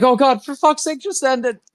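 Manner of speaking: high-pitched exasperated cry; jolted awake and begging for it all to end; startled despair. Acted. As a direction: shriek it out like he's given up. Startled character cries out in despair.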